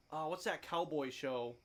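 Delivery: usual speaking voice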